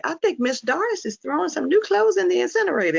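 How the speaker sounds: high-pitched voice of a neighbor reporting; imitated neighbor. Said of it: "neighbors called me to say".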